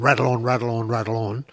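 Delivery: quick, run-together repetition; rattling on fast. Shown as a demonstration. Admitting to horse students a habit, rattling on fast. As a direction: rattle the words off without a gap. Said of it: "I tend to talk fast".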